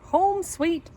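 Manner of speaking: slow, animated read-aloud voice; storybook reading. Acted. Teacher reading a picture book to her students, animated storytelling. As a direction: read it like story time for little kids.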